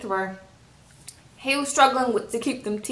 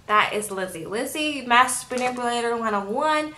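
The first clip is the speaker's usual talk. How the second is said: ordinary talk, nothing marked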